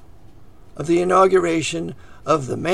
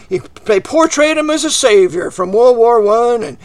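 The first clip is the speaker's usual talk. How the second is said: ordinary delivery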